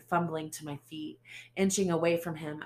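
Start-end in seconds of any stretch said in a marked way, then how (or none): none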